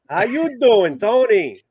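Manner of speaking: high, drawn-out Italian-American wiseguy; playing a Jersey mob guy, Italian-American New York accent; New York mobster accent. Mobster greeting, continued bit.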